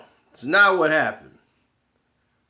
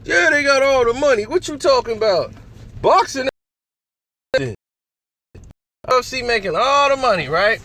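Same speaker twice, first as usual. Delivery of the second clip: raised, insistent fan voice doubling down; mock arguing fan; 9 semitones higher than usual. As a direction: play the stubborn fan digging in.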